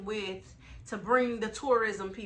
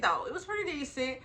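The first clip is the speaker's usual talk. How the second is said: ordinary delivery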